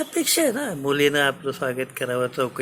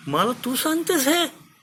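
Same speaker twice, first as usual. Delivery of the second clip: much higher, outraged question; angry king; 12 semitones higher than usual. Acted. King rebukes daughter telling him to cut his soldiers, angry. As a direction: throw it back at her in disbelief. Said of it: "रागा रागानं बोलतो".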